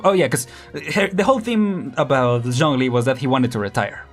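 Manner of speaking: ordinary delivery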